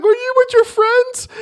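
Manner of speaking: very high, loud whiny question; high pitched needy girlfriend; drunk girl. Retold or imitated. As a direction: squeal it like a clingy drunk girl. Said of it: "She's like".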